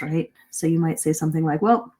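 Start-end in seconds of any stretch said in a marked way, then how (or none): 1.6-1.9 rattled off fast, trailing into blahs; brisk skim over old meeting business; quick recap. Shown as a demonstration. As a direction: rush through what everyone already knows. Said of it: "You say that part quickly".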